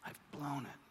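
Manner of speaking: despairing whisper. Retold or imitated. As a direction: breathe it out like you just ruined everything. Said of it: "and you go".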